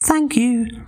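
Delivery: very high pig voice; polite little pig. Acted. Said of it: "said the little pig".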